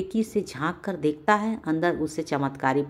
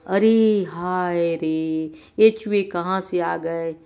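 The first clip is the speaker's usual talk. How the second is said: slow, drawn-out, louder wail in a wife's voice; 3 dB louder, slower than usual. Acted. Wife finds rats in the room, slow drawn-out wail. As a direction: stretch out the lament like a shocked wife. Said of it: "रंगीला की पत्नी बोली".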